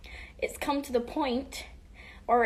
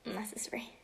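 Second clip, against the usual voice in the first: whispered; 11 dB quieter than usual. Real, not acted. Whispered aside.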